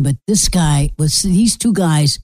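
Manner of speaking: ordinary delivery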